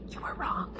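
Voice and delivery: a sly whispered aside; teasing whisper. Real, not acted. Mom whispers a jab at the stranger who guessed wrong.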